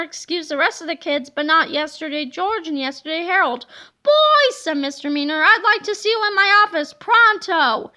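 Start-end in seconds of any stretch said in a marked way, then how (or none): 4.0-4.6 put-on loud, high bark at the boys; stern call. A narrator's reading. Gym teacher calls boys. 5.4-7.9 louder, fast, bossy summons; brisk order. A narrator's reading. Gym teacher orders boys.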